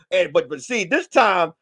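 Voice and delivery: ordinary talk, nothing marked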